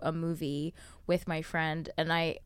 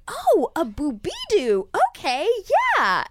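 gullible stranger; 11 semitones higher, 7 dB louder, slower than usual. Retold or imitated. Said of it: "they're like".

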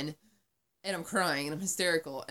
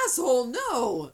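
loud, higher, slow mock shout; angry yell; 8 semitones higher, 10 dB louder, slower than usual. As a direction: snap at the cop. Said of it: "angry retort at cop".

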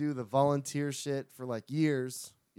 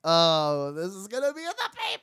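loud, higher excited cop voice with a laugh; imitating excited cops; 5 semitones higher, 8 dB louder than usual. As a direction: ham up thrilled cops who caught a celebrity. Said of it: "The cops gotta know that".

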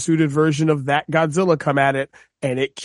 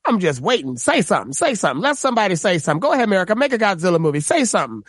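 imitated comedian daring someone to speak up; taunting stand-up routine impression; Cat Williams; 3 semitones higher, faster than usual. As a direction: goad them, swooping pitch on each repeat. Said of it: "just like the Cat Williams joke".

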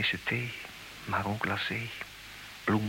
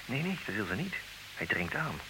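usual speaking voice

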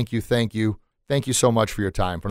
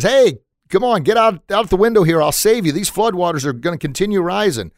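high, loud rescuer's shout; acted holler up to a stranded man; calling out urgently; 8 semitones higher, 5 dB louder than usual. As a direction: urge him out, pitched high and loud. Second rescuer calls to window.

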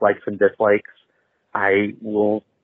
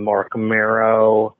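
ordinary talk

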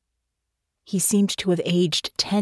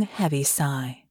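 ordinary delivery, nothing marked

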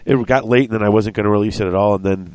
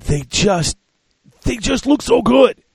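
much higher, louder gush; excited; 4 dB louder, slower than usual. Real, not acted. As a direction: rave about it. Gushing over kit.

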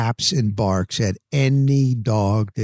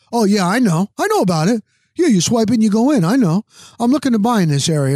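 pushy stranger; 7 semitones higher, 3 dB louder, faster than usual. Retold or imitated. Stranger brushing off his answer.

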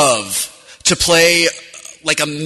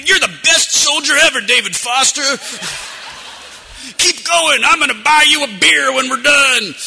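high, loud hyped-up encouragement; cheering on; 11 semitones higher, 4 dB louder than usual. Retold or imitated. Player cheering his soldier on, high and excited. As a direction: cheer your squadmate on, grinning. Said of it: "then I'm like".

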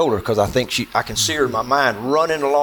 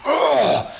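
imitated demonic roar; 13 semitones higher than usual. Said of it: "demonic sounding and so evil sounding".

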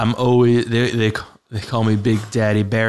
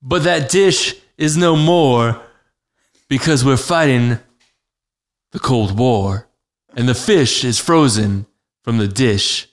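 raised, dramatic verse delivery; slam poetry reply; 3 semitones higher, 5 dB louder than usual. Pretend. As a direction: declaim each line and let it hang.

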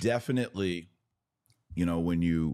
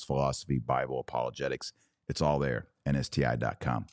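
ordinary talk

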